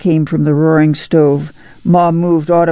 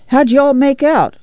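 high-pitched friendly call; lilting hello from next door; neighborly hail; 9 semitones higher than usual. A narrator's reading. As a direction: greet the neighbor with a high lilt. Neighbor pops in to greet Ma.

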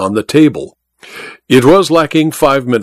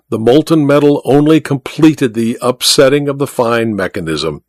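plain reading voice

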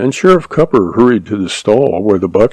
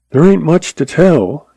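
higher-pitched, hesitant start; slowly; 7 semitones higher than usual. A narrator's reading. Cack begins telling the sheriff about the killing, slowly. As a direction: begin the account reluctantly, unhurried. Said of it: "he began slowly".

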